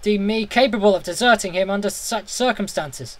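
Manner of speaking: ordinary delivery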